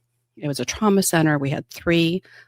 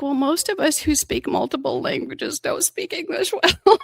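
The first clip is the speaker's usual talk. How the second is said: indignant retort; 10 semitones higher than usual. Retold or imitated. Defending the patient, retold.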